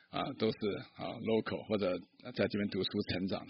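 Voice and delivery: ordinary delivery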